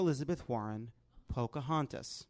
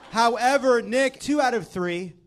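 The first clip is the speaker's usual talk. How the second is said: louder and higher, building to a whooping cheer; excited win announcement; 8 semitones higher, 8 dB louder than usual. Real, not acted. Game host cheering as the contestant wins, excited whoop. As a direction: crown the winner with a big shout.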